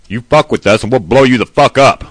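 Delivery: loud, tough threatening bark; manly voice. Retold or imitated. Showing what a president should sound like, loud tough threat. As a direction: sound like a hard-nosed tough guy. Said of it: "You've gotta have a manly voice".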